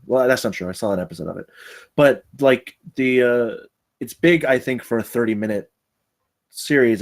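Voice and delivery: ordinary delivery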